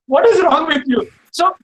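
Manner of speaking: high, loud, exasperated shout; exasperated yell. Retold or imitated. Chef yelling at his careless student, exasperated. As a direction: blast the student in disbelief. Angry chef after two abuses.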